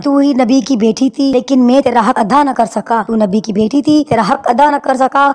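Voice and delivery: loud, impassioned preacher's voice driving the deathbed story; loud, tearful-edged sermon delivery; preaching at full force. Acted. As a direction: preach it to the hall, full voice and fervour.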